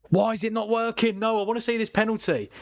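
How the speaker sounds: high, exasperated whine at the screen; pleading with a broken stream; real annoyance, pitched high; frustrated. Real, not acted. Stream won't load for penalty.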